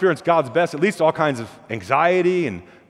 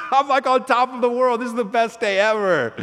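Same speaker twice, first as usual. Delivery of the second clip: put-on higher, over-the-moon boy; on top of the world, pitched up; thrilled kid; 7 semitones higher than usual.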